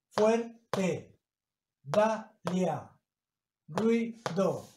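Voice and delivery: "Fuerte. Dalia. Ruido." as fuer-te, da-lia, rui-do; two beats each in "Fuerte. Dalia. Ruido."; syllables split, diphthongs joined. Shown as a demonstration. Teacher showing vowels in one syllable, syllable by syllable. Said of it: "se pronuncian en la misma sílaba".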